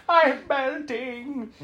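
high, soft, slow dying wail; hammed-up melting death cry; mock melting victim. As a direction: draw it out, fading high. Joke impression of melting victim.

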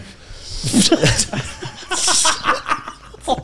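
long, high wheezing laugh; breathless fit of giggles; helpless laughter. Real, not acted. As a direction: crack up till the voice goes. Cracking up at the joke.

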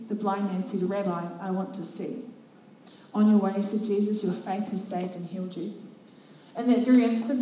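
ordinary delivery

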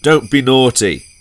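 ordinary talk

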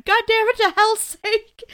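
very high, strained cursing; hammed-up exasperation, dragging out the ending; exasperated movie quote. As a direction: squeal the curse in mock frustration. Quoting Frank's exasperated line again.

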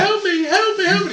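very high mock-distressed falsetto; man posing as woman. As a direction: wail for help in a fake woman's voice. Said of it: "bloke dressed up as a woman".

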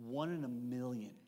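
a soft low voice breaking bad news; quiet, deadpan letdown; Mary letting him down. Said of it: "She goes".

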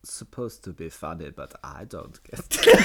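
mock annoyed German reading; annoyed German accent. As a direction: read it like an irritated German. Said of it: "Can you read out as a German? An annoyed German".

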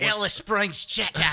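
high, loud slow Aussie menu call; mock Australian ad. Keeping the Outback bit going in hammed-up Australian. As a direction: ham up the Outback menu item. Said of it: "the Outback guy".

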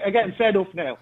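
ordinary delivery

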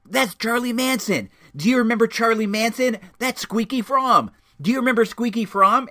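high, loud mock moviegoer chatter; full conversational volume. Older moviegoers spotting names on screen, loudly blurted. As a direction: imitate an oblivious older talker at the movies. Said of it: "full conversational tone".

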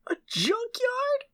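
incredulous. Real, not acted. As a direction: shoot way up in pitch, can't believe it. Incredulous at junkyard advice.

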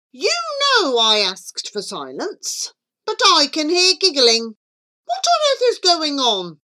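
fast, no punctuation. A narrator's reading. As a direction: run it together without pauses. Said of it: "he spoke very fast and without punctuation".

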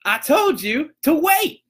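high, slow, exasperated put-on voice; mock scolding, drawn out; irritated cashier. Cashier snapping back at the customer, high and slow. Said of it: "she's like".

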